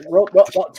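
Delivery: loud, high stammering call after the robot; stammered guard shout. Acted. Guards shouting after the fleeing robot, loud and high. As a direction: yell the name in broken bits, high and loud.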